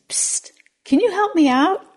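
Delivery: high squeaky little frog. Acted. Frog calling up to a passing old man. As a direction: pipe up small from the ground.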